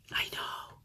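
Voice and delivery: hushed, breathless whisper. Real, not acted. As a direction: lean in and whisper the shock. Shocked aside to viewers.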